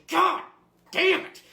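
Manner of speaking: high, angry two-part burst; exasperated outburst. Acted. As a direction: explode at the clueless clerk. Customer loses patience with clueless clerk.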